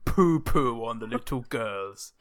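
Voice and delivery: slow mock-serious poet voice; Edgar Allan Poe. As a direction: recite it slowly as a put-on grave poet. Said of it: "I'm Edgar Allan Poe".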